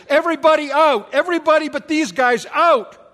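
loud, high commanding shout; shouted order. Acted. Joseph ordering everyone out, loud shout. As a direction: clear the room with a bellow.

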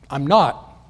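short, curt denial; abrupt answer. Retold or imitated. John denies being Elijah, abrupt. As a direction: cut it off abruptly. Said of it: "John's answer is abrupt".

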